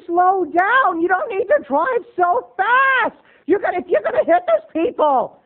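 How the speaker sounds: building from fussing to high frantic shouting; backseat driver mom. Retold or imitated. Mom nagging her son at the wheel, rising to a high panicked shout. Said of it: "telling me how to drive".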